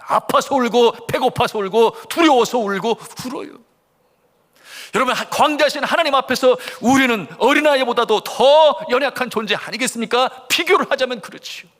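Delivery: high, forceful preacher's delivery; impassioned preaching. Acted.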